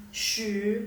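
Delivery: "shi" drawn out with a rising pitch; second-tone shi. Shown as a demonstration. Teacher demonstrates shi for ten, rising second tone. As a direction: slide upward through a long "shi". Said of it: "Jadi ini nadanya kedua".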